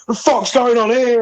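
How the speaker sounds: high, loud mock yell of a furious neighbour; angry shout. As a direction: bellow it from the garden. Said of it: "he was there in his garden shouting".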